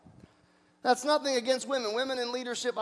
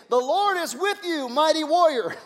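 angel's proclamation; 4 semitones higher, 3 dB louder than usual. Acted. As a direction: proclaim it like a heavenly messenger, raised pitch. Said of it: "The angel of the Lord had said".

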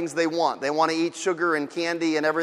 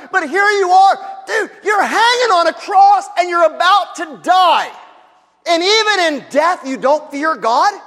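shouted rebuke; 10 semitones higher, 6 dB louder than usual. Acted. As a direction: yell it down the cross at him. Criminal rebukes the scoffer.

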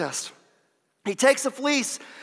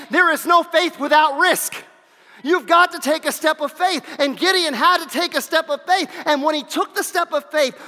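loud, driving sermon build; fiery preacher pressing the point; preaching at full force; 3 semitones higher, 5 dB louder than usual. Acted. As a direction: push it hard to the back row.

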